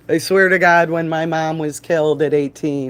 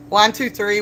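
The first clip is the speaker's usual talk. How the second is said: usual speaking voice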